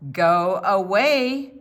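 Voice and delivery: loud, slow gruff command; gruff go away. Acted. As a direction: send the second cat off slowly and loudly. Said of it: "said the man".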